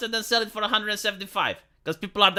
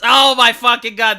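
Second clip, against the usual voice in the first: louder; cracking up at own joke; loud amused exclaim; delighted outburst; 10 dB louder than usual. Real, not acted.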